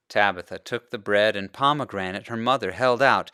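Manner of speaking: ordinary delivery, nothing marked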